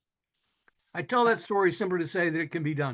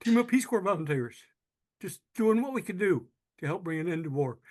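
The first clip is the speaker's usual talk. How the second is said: ordinary delivery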